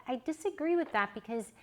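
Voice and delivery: usual speaking voice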